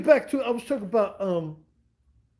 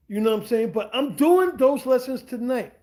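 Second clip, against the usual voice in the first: ordinary talk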